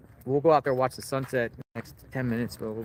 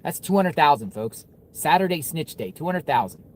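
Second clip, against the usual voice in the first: salesy pitch; 4 semitones higher, 4 dB louder than usual. Real, not acted. Pitching the reward to viewers.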